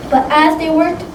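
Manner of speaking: plain reading voice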